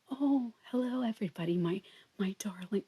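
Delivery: soft, syrupy mock greeting; hushed fake sweetness, fading to a whisper; passive aggressive. Mimicking forced niceness to everybody, passive aggressive.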